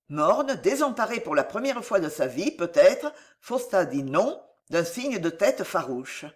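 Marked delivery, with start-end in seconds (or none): none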